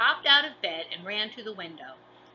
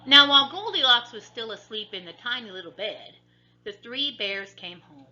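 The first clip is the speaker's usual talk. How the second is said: plain reading voice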